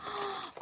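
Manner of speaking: soft gasp. Real, not acted. Quiet gasp of delight.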